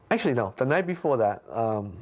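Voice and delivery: ordinary delivery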